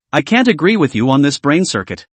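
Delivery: usual speaking voice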